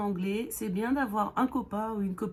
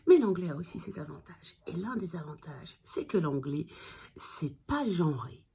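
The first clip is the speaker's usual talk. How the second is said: ordinary delivery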